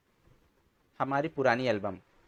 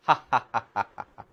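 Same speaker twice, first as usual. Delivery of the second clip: mocking laughter; 5 dB louder than usual. Laughing at the man.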